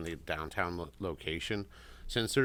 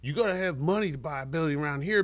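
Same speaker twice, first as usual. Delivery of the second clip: higher, louder cocky taunt; mocking the neighbor; 7 semitones higher, 5 dB louder than usual. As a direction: ham up a smug older guy talking down. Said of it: "He goes".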